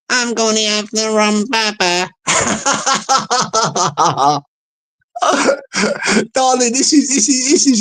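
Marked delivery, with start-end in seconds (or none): 0.1-2.1 lightly voiced English lady; a hint of an English accent, put on. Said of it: "you've got a blooming English accent".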